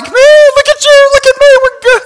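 very high, squealing celebration; giddy amazement. Acted. Healed lepers marvel at each other, giddy.

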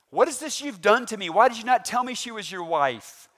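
angry Pharaoh. Acted. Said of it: "pretty good to not separate Abram's head".

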